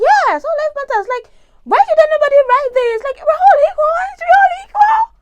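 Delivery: very high, loud mock outrage; naive outburst. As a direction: cry out in exaggerated naive disbelief. Acts out her naive past reaction.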